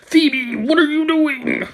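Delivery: high, laughing imitation of a panicked man; laughing mock panic; laughing mock alarm. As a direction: blurt it out through laughter, high. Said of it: "He said".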